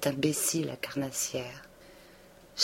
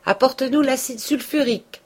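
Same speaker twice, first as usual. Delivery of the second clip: put-on high, loud command to the boy; calling out; 10 semitones higher, 5 dB louder than usual. A narrator's reading. The apothecary calling to Justin for the acid, high and loud. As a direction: bark the order across the shop. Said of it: "cria l'apothicaire".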